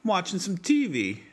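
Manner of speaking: light southern lilt, T in T V stressed and high; mock southern T V, T leaned on. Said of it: "People in the South say".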